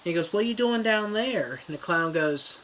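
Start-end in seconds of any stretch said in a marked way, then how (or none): 0.3-1.6 put-on higher, curious child calling down; high kid voice. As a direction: call down the drain like a boy. Georgie calls into drain.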